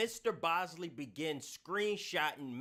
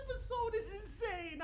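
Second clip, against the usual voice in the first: high squeaky laughing disbelief; squealing laughter. Real, not acted. Still laughing at the episode.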